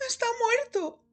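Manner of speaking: high-pitched whimpering cry; distressed wail. A narrator's reading. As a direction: wail it in horrified shock. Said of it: "gimoteó".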